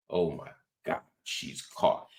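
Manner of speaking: hushed mock horror; mock shock. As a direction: half-whisper it like a fake scandal. Mocking the scandalized conservatives.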